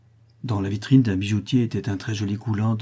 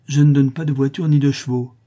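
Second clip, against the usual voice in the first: curt refusal, higher and louder; ton sec; 5 semitones higher, 7 dB louder than usual. A narrator's reading. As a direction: state it dryly and firmly, raised voice. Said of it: "dit-il d'un ton sec". (d'un ton sec)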